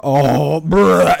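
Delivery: loud groan into a heave; hammed-up vomiting noise; loud mock retching. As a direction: act out throwing up noisily. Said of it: "He starts vomiting".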